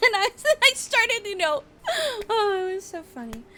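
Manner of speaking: high, giggly, breaking into laughter; giggling through it. Real, not acted. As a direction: laugh your way through the memory. Gushing about cute Deku cosplayer.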